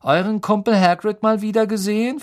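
usual speaking voice

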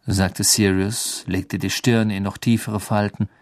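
plain reading voice

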